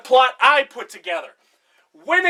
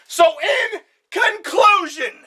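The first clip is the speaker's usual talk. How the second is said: out of breath; 7 semitones higher, much slower than usual. Real, not acted. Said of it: "I'm out of breath".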